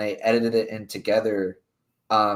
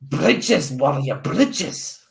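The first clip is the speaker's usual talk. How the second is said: a rough, hammed-up Scottish accent; bad Scottish accent, half-joking; shitty Scottish accent; 6 semitones higher, 4 dB louder, much slower than usual. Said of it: "That's my shitty Scottish accent".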